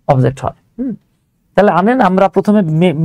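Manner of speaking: ordinary talk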